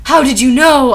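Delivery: loud, startled question, acted; surprised. A narrator's reading. Narrator asking Voldemort, in acted surprise. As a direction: blurt it out in mild astonishment. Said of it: "I asked in a surprised".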